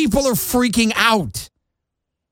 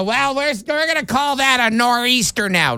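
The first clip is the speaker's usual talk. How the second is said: mocking meteorologists; 5 semitones higher than usual. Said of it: "what meteorologists decided".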